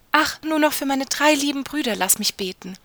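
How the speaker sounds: desperate pleading. A narrator's reading. Wife begging to pray for her brothers, desperate plea. Frau fleht um Aufschub.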